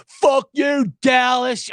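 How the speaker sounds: loud jeering shout; fans yelling. Retold or imitated. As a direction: yell it like a rowdy Eagles fan. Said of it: "Everyone go".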